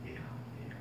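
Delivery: a barely voiced whisper calling a child; God calling Elijah in a tiny whisper; still small voice. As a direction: whisper it as if from far inside. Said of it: "아주 작은 음성이 들려요".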